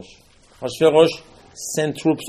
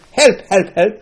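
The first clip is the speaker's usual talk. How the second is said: raised, higher-pitched pleading shout; mock cry for help; 7 semitones higher, 6 dB louder than usual. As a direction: call out urgently, acting it. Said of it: "you don't scream to Hashem".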